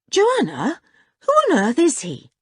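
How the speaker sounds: put-on high-pitched astonished boy's question; baffled demand. A narrator's reading. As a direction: ask in sheer bewilderment. Dick demands to know about stranger.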